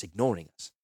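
plain reading voice

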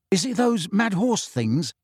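tentative, puzzled query; uncertain. A narrator's reading. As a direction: ask it unsure of yourself. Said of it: "said Ron uncertainly".

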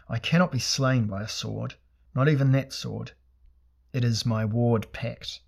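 plain reading voice

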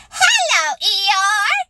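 high, excited little squeak; squeaky. A narrator's reading. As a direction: squeak it like a tiny eager joey. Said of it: "he squeaked".

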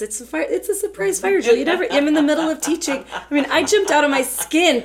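high, loud, flustered retelling; startled. Retold or imitated. Teacher recalling jumping at the fire drill, startled and loud.

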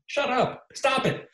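high-pitched, exasperated telling-off; mock scolding. Fan to a team threatening to quit F one, mock scolding. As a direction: scold in a squeaky, incredulous pitch.

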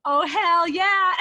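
loud, high, drawn-out fan cheer; emphatic enthusiasm. Retold or imitated. Answering the editor about Backstreet Boys, wildly enthusiastic. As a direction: belt it out with hammed-up delight.

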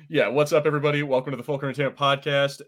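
ordinary talk

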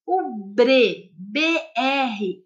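ordinary talk, nothing marked

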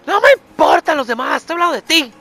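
mock scolding parent. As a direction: nag like a parent.

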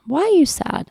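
imitating little daughter. Mother voicing her daughter asking why she's sad, childlike. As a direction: pipe up like a curious toddler.

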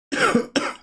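sudden choking cough; choking on yerba mate. Real, not acted. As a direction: cough as mate goes down wrong. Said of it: "Perdón, me atraganté con la yerba". (tos atragantada con la yerba)

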